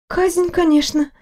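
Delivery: a fussing nurse who cannot stop talking; chatty nurse. Acted.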